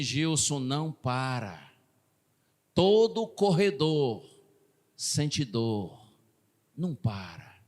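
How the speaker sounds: low, slow brotherly encouragement; steady him gently, phrase by phrase; comforting, motivating. Retold or imitated. Brother urging a hurting runner on, comforting. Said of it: "pra me confortar, pra me motivar".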